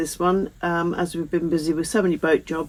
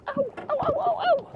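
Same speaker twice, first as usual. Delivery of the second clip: high yelps of pain; getting a leg cramp; 4 dB quieter than usual. Real, not acted. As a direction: cry out ow, ow in pain. Pain while climbing out.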